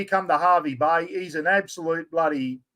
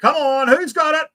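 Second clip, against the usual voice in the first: excited; 11 semitones higher, 5 dB louder than usual. Real, not acted. Building suspense for prize draw.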